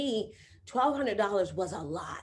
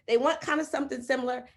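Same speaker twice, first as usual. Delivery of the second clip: ordinary delivery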